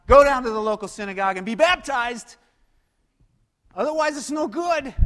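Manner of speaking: hammed-up fussy demand, rising loud; mock legalist. Preacher mocking a church making the thief earn salvation. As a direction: play a petty rule-keeper.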